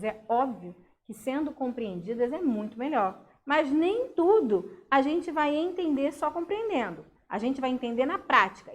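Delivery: normal talking voice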